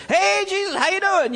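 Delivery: high, loud, bright hello to a friend; cheerful greeting. Pretend. Disciple greeting risen Jesus at the tomb, loud cheerful call. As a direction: call out happily across the garden.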